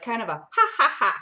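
loud breathy ha, ha, ha to show the j sound; teaching children the Spanish j as ha; h sound demo. Shown as a demonstration. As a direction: push out a big ha like a laugh of air. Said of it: "It's like the letter E, and then".

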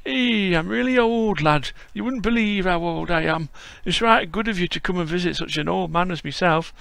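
put-on old Yorkshire grandad greeting a visitor; frail old Yorkshireman. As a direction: play a creaky old Yorkshire lad-caller. Voicing old Yorkshire Bob.

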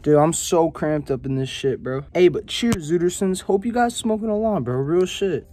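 ordinary talk, nothing marked

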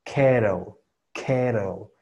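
"kettle" as "keh-rol", T softened to a tap; stressed "ke", then tapped "rol". Shown as a demonstration. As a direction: say "kettle" with R-like middle. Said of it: "la primera que sería la K".

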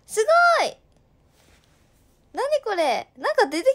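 high, loud delighted shout; surprised shout. Real, not acted. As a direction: gasp out at the sudden news. Sudden ranking pop-up. (驚いて高く叫ぶ)

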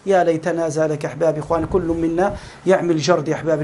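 normal talking voice

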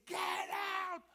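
sudden high yell; shouted get out; retold shout. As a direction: bark it out like an angry manager. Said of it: "He's screaming. He's shouting".

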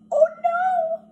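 very high squeal of alarm. Acted. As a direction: squeak it out in panic.

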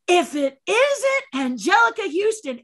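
high, loud theatrical greeting; hailing a friend. Retold or imitated. Stunt double greets actress from behind, loud high hail. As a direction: call grandly across a big room. Hailing her across soundstage.

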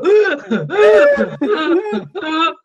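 high, loud laugh on in-breaths; inhaled laugh. Shown as a demonstration. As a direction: laugh while gasping air in. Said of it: "some people are breathing in".

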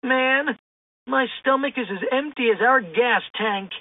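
a hint of a hungry slacker's whine; Shaggy complaining. A narrator's reading. Shaggy grumbling to friends about hunger, mild whine. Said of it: "Shaggy complained".

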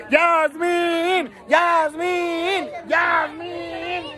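drawn-out cheering call of a name; repeated celebratory call. Real, not acted. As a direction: holler the name, stretching the last vowel. Calling out the graduate's name in farewell.

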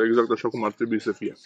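ordinary delivery

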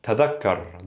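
"tadhakkar" with voiced th and doubled k; MSA pronunciation. Shown as a demonstration. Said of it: "This is an M S A".

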